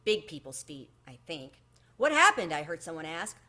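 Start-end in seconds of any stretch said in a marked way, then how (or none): 1.9-2.5 sharp, raised question; alarmed question. A narrator's reading. Grown-up bursting into the room, raised and alarmed. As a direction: ask it in sudden alarm.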